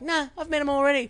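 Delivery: high put-on mocking voice of a friend; mock Kelly. Teasing Kelly about meeting mates, high mock voice. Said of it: "You're like".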